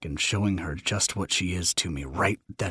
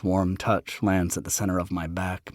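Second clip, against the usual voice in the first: ordinary delivery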